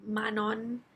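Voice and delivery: "Manon" said MAH-non, open first vowel; trying a pronunciation. Shown as a demonstration. Reviewer trying another version, "Manon" as MAH-non. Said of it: "I don't know how to pronounce the name".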